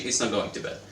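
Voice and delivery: ordinary delivery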